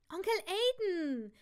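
high, drawn-out greeting; calling out. Acted. Niece greets her uncle politely, calling out high and slow. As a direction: greet him loudly, stretching the name.

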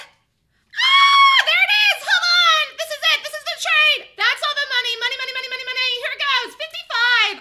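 high, loud triumphant yells; excited shouting. Real, not acted. Trader shouting to his viewers as the trade hits. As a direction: scream it as the trade pays off.

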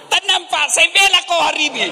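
squeaky high voice begging for calm, with a laugh; mock-frantic husband pleading, way up high. Preacher mimics a husband soothing his angry wife, high-pitched.

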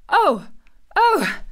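high, loud cries of delight; delighted cry. A narrator's reading. Boy hears good news from his friend, delighted cry. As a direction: cry out, overjoyed. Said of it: "cried out in delight".

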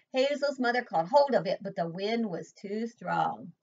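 usual speaking voice

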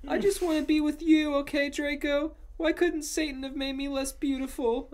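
high, whiny, pleading protest; mock angry shouting. A narrator's reading. Ebony lamenting her beauty to Draco, high angry whine. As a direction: whine it far above the narration. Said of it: "I shouted angrily".

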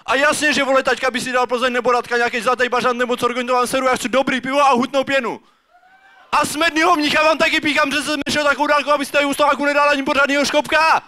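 high, indignant tirade building to the end; hammed-up outrage, pitched well above normal; angry dad rant. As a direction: rant in rhythm like a dad cheated of his beer. Dad's beer rant in restaurant.

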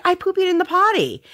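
put-on high childlike voice, pleased; imitating proud toddler. Mother voicing her toddler boasting about the potty, high and childlike. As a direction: announce it like a proud little girl. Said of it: "she's like".

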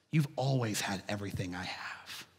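soft breathy whisper of a loving father; gentle whisper. Acted. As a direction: whisper it tenderly, like a secret. Father's gentle words in parable.

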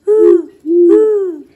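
belted high woo-woo, very loud; loud playful hoot. Acted. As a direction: call it out like a train whistle. Storyteller voices sound effect.

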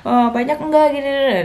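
mock the people who doubt her milk; doubting relatives. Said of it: "banyak yang bilang".

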